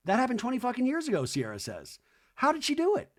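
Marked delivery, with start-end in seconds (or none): 0.1-1.3 fast rant. Retold or imitated. Sierra ranting. 2.4-3.1 amazed. Real, not acted. Speaker amazed.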